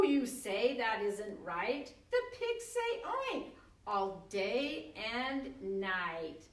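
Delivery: playful read-aloud, slowing at the end; reading a picture book aloud, animated; reading to children. Acted. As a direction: read it like a toddler's rhyme. Reads board book aloud.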